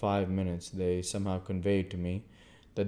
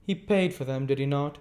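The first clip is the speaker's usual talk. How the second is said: put-on young lady; 7 semitones higher, 5 dB louder than usual. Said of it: "asked Estella".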